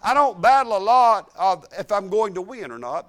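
usual speaking voice

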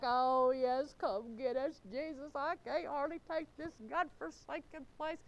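hammed-up weepy begging to be rescued; mock crying. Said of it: "and they're crying, and they're like".

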